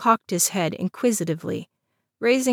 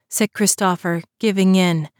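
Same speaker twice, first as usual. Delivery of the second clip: ordinary delivery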